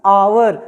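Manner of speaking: "आवर" with no H sound at the front; silent H. Shown as a demonstration. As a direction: start "आवर" straight on the aa vowel. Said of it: "न कि हावर कहते हैं".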